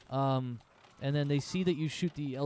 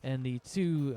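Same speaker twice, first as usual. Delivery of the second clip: ordinary talk, nothing marked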